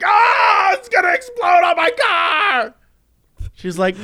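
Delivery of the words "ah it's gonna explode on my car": high shouted panic about explosion; hammed-up high panic; panicked shout. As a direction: scream like a frightened driver.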